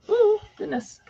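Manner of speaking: a high, startled little ooh; surprised ooh. Real, not acted. Crafter startled by a slip at her desk, surprised. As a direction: catch yourself as something slips. Nearly drops something, making mess.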